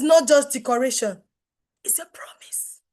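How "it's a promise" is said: whisper. Acted. Whispered emphasis on branding promise.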